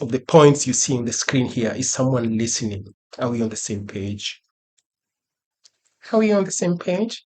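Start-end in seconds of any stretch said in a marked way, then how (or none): 6.1-7.2 much higher, rising question; high questioning repeat. Pretend. Teacher checking that the class follows, high questioning voice. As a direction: ask it high, lifting at the end.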